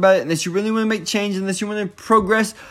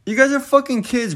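ordinary talk, nothing marked

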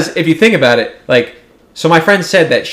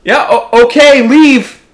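loud, higher yell, half laughing at himself; yelled up the stairs; 11 semitones higher, 7 dB louder than usual. Retold or imitated. Shouting up the stairs at the crying intruder, loud and rattled. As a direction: holler the brush-off up the stairs. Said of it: "I literally yelled up the stairs".

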